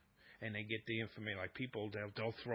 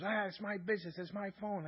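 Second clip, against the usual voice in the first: higher, defensive retort imitating the wife; 8 semitones higher than usual. Wife brushing off husband's questions, defensive and higher. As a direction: toss it back like she's guarding her phone. Wife's retort voiced in story.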